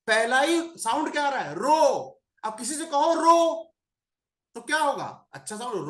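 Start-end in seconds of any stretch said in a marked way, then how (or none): 3.1-3.7 रो said higher, as an order; telling someone रो. Shown as a demonstration. Teacher shows रो said to someone as an order. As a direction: say रो like a command. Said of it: "रो मतलब रोना यार".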